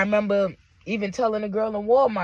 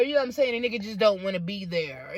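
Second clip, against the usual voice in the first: ordinary delivery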